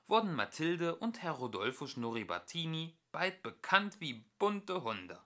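usual speaking voice

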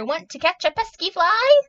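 high, loud rooster voice; rooster character. A narrator's reading. Rooster inviting the spider to catch a fly, high and loud. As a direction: keep crowing through the question. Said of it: "crowed the rooster".

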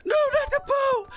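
high-pitched, loud mock-distressed cry; squealed, dramatic protest. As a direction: cry out in playful dismay. Game goose loses its bow.

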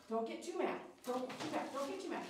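ordinary delivery

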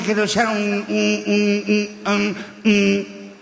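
drawn-out hums on one held note; stuck, drawn-out stammer. Retold or imitated. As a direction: stall on the word, tipsy and stuck. Imita al compadre borracho pidiendo orinar. (titubeo alargado, atascado)

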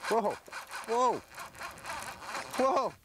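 excited. Real, not acted. Big fish suddenly pulling hard.

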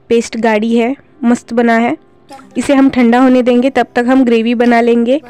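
ordinary talk, nothing marked

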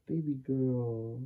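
soft, slow soothing; calm her gently. Real, not acted. Husband soothing crying wife.

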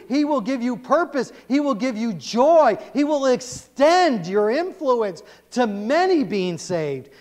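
high, loud, slow pulpit proclamation; preaching at full force. Acted. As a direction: declare each promise slowly to the crowd.

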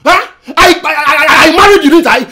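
loud, indignant yelling at his wife; angry shout. Acted. Husband yells at his wife over her accusation, angry shout. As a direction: shout him down, outraged.

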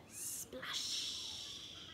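long hushed swoosh; soft, drawn-out splash; whispered sound effect. A narrator's reading. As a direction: whisper it like spraying water. Sound word acted in whisper.

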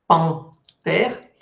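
"Pan, terre" in two detached syllables; syllable separation. Shown as a demonstration. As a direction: pause after nasal "Pan", then "terre". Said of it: "Hice la separación en sílabas".

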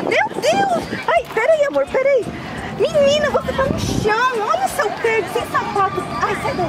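sudden high-pitched alarm; alarmed mother. Pretend. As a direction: exclaim high, then ease down while scolding. Finds daughter barefoot on the ground.

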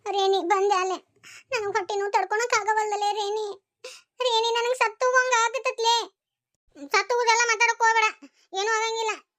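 moaning in pain.

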